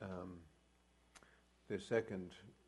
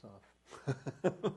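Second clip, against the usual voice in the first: laughing; 5 semitones higher, 8 dB louder than usual. Real, not acted. Tells of laughing at night.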